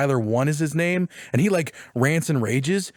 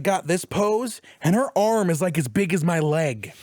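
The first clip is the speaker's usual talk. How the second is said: ordinary talk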